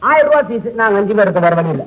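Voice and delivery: ordinary delivery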